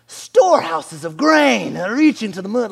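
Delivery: loud, high, slow royal proclamation; old Persian king. Acted. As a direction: thunder in awe as a hammed-up king. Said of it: "it'd be like".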